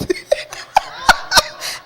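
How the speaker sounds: high burst of laughter; real laugh. Real, not acted. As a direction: laugh out at your own needless panic. Laughs at forgetting about pay.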